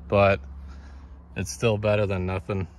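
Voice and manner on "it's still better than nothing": normal talking voice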